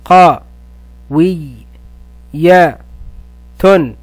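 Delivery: قوية split into قا، وي، يا، تن with pauses; syllable split. Shown as a demonstration. Teacher splits قوية into syllables for the class. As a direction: sound out قا، وي، يا، تن slowly one by one. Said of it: "ثم أحللها إلى مقاطع وحروف".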